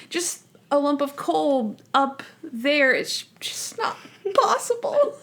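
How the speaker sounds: high, fussy objection, imitated; hammed-up high protest breaking into laughter; Monk. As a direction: insist on it in a high mock-flustered voice. Monk earnestly disputing the joke.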